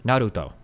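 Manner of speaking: Naruto with a tapped r and even syllables; unstressed, Japanese-style Naruto; Japanese pronunciation. Shown as a demonstration. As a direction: say Naruto evenly, lightly tapping the r. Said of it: "closer in Japanese would be".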